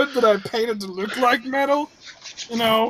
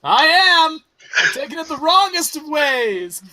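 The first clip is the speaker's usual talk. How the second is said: loud, high, mock-offended holler; calling back; 5 semitones higher, 3 dB louder than usual. Rejected schemer answering as he sidles away, calling back. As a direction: yell it back as you leave. Prospero shouts back while leaving.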